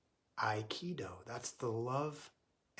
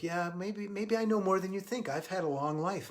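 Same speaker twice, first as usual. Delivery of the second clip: lightly voiced old man, higher; gently acted old man's pitch lift; old guy; 7 semitones higher, 3 dB louder than usual. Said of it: "the old guy goes".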